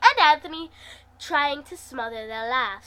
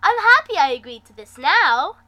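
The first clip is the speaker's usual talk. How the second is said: excited; 3 semitones higher, 11 dB louder than usual. Acted. Thrilled after the ride.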